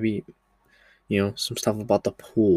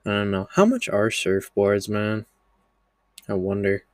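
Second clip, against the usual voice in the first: normal talking voice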